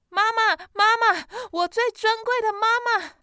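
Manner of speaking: 气喘吁吁地高声喊妈妈; breathless calling. Acted. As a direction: call out high and breathless to mother. 弟弟喘着气喊妈妈. (气喘吁吁地大声喊妈妈)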